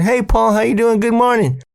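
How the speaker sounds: high, sugary fake greeting; overly bright smile-voice; mock-friendly high hello; fake cheerful. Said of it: "I'm smiling".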